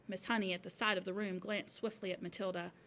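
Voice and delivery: ordinary delivery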